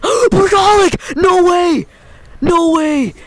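screaming with joy; high ecstatic shrieks; shrieking jackpot winner. Real, not acted. Rare jackpot perk pull.

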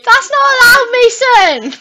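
high, loud indignant yell; shouted scolding. Real, not acted. Player scolds Mason for breaking rules, shouting. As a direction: tell them off at full volume.

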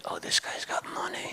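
breathy aside whisper to the crowd; stage whisper. Acted. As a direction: lean in and whisper it.

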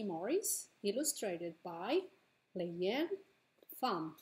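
ordinary talk, nothing marked

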